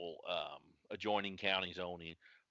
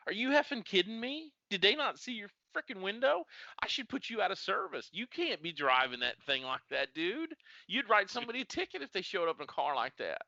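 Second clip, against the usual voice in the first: higher pitched, exasperated boss scolding; incredulous sergeant rant; 11 semitones higher than usual. Retold or imitated. Sergeant scolding officer over kicked-out window, high exasperated rant. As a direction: rant like a boss who can't believe it.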